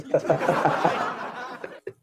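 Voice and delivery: trailing laugh, dying away; laughter. Real, not acted. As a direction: laugh it out and let it fade. Laughing after own joke. (tawang humihina)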